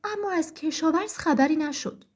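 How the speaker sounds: usual speaking voice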